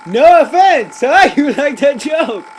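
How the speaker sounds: punchline repeated through loud laughter, pitched high; loud laughing, half-shouted; laughing at own joke. Real, not acted. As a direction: crack yourself up loudly.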